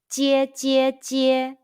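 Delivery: "Jie jie jie" slow, high and level; drawn-out first-tone drill on "Jie". Shown as a demonstration. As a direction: chant "Jie" three times on the same high held note. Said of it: "high pitch first tone all together".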